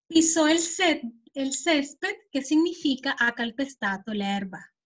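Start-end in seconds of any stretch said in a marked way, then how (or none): 0.1-0.5 "pisó" with the accent on the final O; stress on the O of pisó. Shown as a demonstration. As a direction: say "pisó" as pi-SÓ, pitched up. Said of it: "colocamos el acento en la O".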